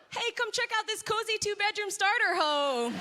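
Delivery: bubbly realtor pitch with a laugh; real estate agent. Shown as a demonstration. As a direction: sell the house to a buyer.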